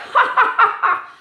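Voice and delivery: high, loud breathy puppet giggle; squirrel giggle. Pretend. Puppet squirrel laughs after promising, high loud giggle. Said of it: "He's laughing".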